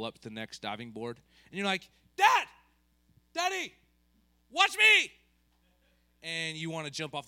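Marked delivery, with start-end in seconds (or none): 2.2-5.1 put-on high, loud kid shouting Dad; excited kid yelling for attention; child calling dad.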